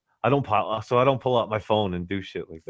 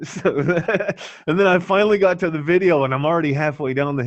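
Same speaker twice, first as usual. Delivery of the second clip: laughing, higher and louder; 8 semitones higher, 5 dB louder than usual. Real, not acted. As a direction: talk through a burst of laughter. Laughing while recounting crash.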